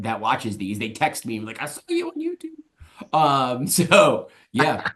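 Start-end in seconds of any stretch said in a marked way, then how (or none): 1.6-3.2 soft, high, slow mock Northern Irish voice; Northern Irish relative. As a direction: mimic Northern Irish family in a high, drawn-out quote. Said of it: "For all of my Northern Irish family".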